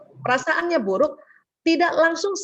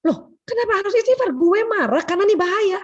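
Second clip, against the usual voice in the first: offended husband; 7 semitones higher than usual. Retold or imitated. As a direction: object sharply, feeling insulted. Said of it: "Dia tersinggung". (suami tersinggung membalas istri dengan nada tinggi)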